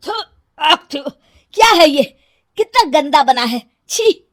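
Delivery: loud disgusted husband spitting. Acted. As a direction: recoil from awful food. Husband spits out food.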